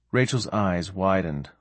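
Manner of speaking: ordinary delivery, nothing marked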